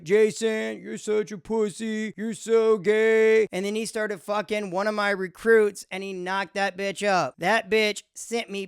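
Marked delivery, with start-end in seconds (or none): 0.0-3.5 lightly voiced mocking supervisor, slow at the end; a hint of a sneering boss; supervisor's taunt. Comic imitating his former supervisor taunting him, lightly mocking. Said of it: "Every day he'd be like".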